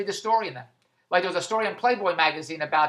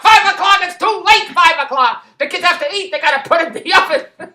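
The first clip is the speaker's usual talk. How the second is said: much higher, louder mock outrage; exasperated protest; 9 semitones higher, 10 dB louder than usual. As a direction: squawk that five is too late. Mother of the kids protesting the time.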